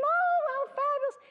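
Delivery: high, loud squealing scream of delight; teenage scream. Retold or imitated. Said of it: "I screamed just like I was a teenager".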